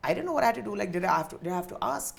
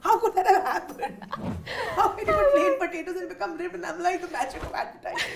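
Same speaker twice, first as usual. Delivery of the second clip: high, loud, laughing questions; laughing disbelief; 8 semitones higher, 6 dB louder than usual. Real, not acted. Retells own teary disbelief, laughing.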